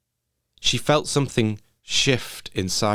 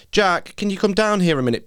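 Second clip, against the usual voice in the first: raised and high, calling up the stairs; calling upstairs; 10 semitones higher, 4 dB louder, faster than usual. Acted. Faye calls Jack down the stairs, loud and high. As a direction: call the boy down from the hall. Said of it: "called his name".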